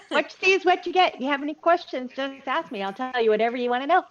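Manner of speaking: ordinary delivery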